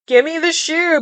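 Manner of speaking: snatch at it like a jealous sister; demanding stepsister. Retold or imitated. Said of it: "is like".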